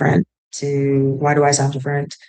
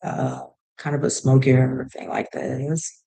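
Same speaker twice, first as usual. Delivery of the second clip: vocal fry demo; 2 semitones lower, 3 dB quieter than usual. Shown as a demonstration. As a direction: let the voice crackle down in the throat. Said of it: "where you talk like this".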